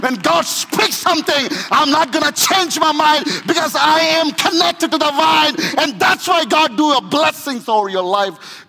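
loud, high, ringing preacher's call; shouting preacher, music underneath; full-force preaching. Acted. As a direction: preach it out over the crowd.

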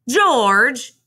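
loud, high call of a name; storybook farmer's wife calling out; excited calling out. A narrator's reading. Missus Rankins rushes up calling George.

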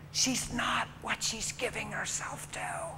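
hushed tender whisper. Real, not acted. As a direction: breathe it softly to the crowd. Tender aside in sermon.

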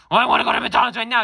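put-on very high, loud and fast child yell; kid yelling. Boy yelling at his parents across the whole mall. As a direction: scream it like a spoiled boy. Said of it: "you were yelling at the whole mall".

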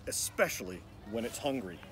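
soft, secretive storyteller warning; hushed warning. A narrator's reading. Narrator quietly warning young listeners of danger. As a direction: lean in and warn the reader in a hush.

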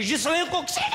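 high shouted cry of a child playing a ladybug; a child shouting proudly in improv class; shouted kid line. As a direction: yell it out like a kid in drama class. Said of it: "Il y en a un autre qui criait".

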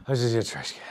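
winded from sprinting; breathless after running; out of breath. Real, not acted. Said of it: "Ah, I'm out of breath".

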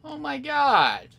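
high-pitched real ranting; exasperated outrage. Real, not acted. Player jumped by three enemies, exasperated outrage. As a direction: cry out in disbelief, voice pitched high.

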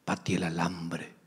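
lightly voiced young man's slang; slangy young man. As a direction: toss off the slang line. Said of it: "oiga esta expresión".

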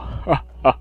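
villainous cackle; hammy evil laughter. Acted. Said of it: "Olha só que risada maligna".

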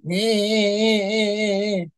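high sustained whining noise; plane engine whine. Retold or imitated. Storyteller shows the plane struggling, whining imitation. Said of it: "the plane is like".

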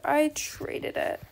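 slow whisper; a soft whispered I traded it; whispered realization. Real, not acted. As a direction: breathe it out slowly in realization.

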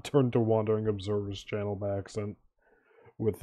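normal talking voice

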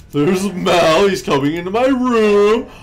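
panicked. Real, not acted. Killer coming into room.

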